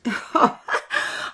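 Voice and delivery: breathy teasing hare laugh; mocking laugh. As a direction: laugh at the slow tortoise. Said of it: "Henry laughed".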